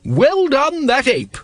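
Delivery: very high, loud congratulation; booming grand-lady hurrah; hearty cheer. A narrator's reading. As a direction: call out praise at full volume. Lady Ramkin loudly cheers the ape.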